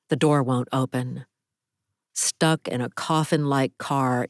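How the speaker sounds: ordinary delivery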